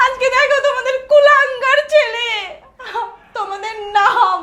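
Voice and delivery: loud, anguished crying wail. Acted. Sister crying out to parents about her brother, tearful wail. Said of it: "তুমি এভাবে কান্না করবা না".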